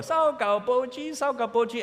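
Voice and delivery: imitated singsong vendor's call; newspaper collector's call. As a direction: call out like the old-newspaper van passing by. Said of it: "the old newspaper".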